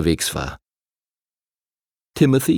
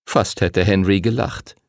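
ordinary delivery

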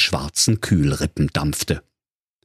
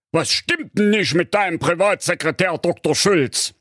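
king voice; 9 semitones higher than usual. Acted. King speaks to fake count.

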